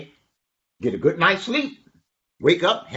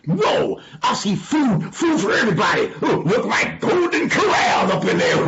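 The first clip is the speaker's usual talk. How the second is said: loud, high amazed exclaiming; excited donkey; 8 semitones higher, 5 dB louder than usual. Acted. Donkey sees food through the window, loud high excitement. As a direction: holler it with mounting delight.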